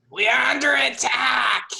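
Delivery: high, loud battle cry; yelled alarm. Acted. Game master as enemy warning his fellows, yelled alarm. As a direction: raise the alarm at full yell. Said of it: "and yells to these guys".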